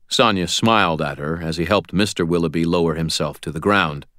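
plain reading voice

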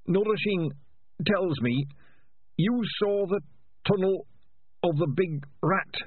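put-on high, thin old rat voice; an old rat leader, weak but probing; frail old rat. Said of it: "said Ham and Pork".

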